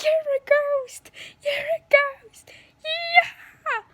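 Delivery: high, drawn-out accusing yell; mock attack cry. [name] attacking Keelan as a ghost, high mock yell.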